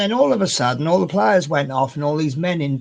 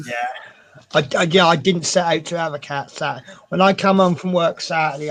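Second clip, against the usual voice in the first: ordinary talk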